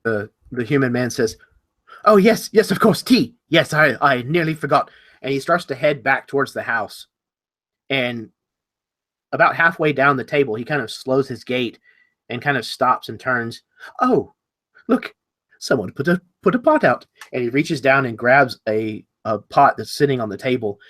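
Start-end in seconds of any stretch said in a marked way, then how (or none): 2.0-4.9 higher, louder, sudden recollection; flustered remembering. Acted. Host reminded of tea he forgot, flustered. 14.0-17.0 high, halting discovery; pleasant surprise. Acted. Host finding a pot already out, pleasantly surprised.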